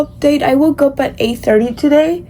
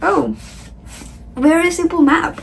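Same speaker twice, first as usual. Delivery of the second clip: ordinary talk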